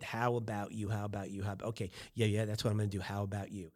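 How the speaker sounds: quiet, rehearsing "How about you?" under his breath; muttered "How about you?" repeated to himself; muttering to himself. Pretend. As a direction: softly practise "How about you?" like a nervous guest psyching himself up. Nervous guy rehearsing the line to himself.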